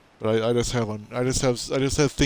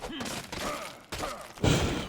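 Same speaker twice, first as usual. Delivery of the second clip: long sigh; 3 dB quieter than usual. Acted. Lament about the bears.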